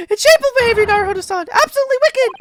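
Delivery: put-on high, loud girlish scolding. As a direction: scold him in a shrill girl voice. Heroine scolding.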